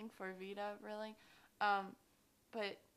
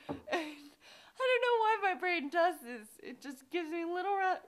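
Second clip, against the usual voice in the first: laughing; 9 semitones higher, 10 dB louder than usual. Real, not acted. Laughing while crying out.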